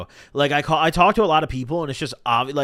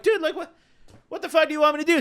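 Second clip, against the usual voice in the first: much higher, pleading in disbelief; exasperated disbelief; 12 semitones higher, much faster than usual. Retold or imitated. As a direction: throw your hands up at the parking guy. Exasperated at the parking manager.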